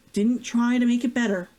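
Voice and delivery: plain reading voice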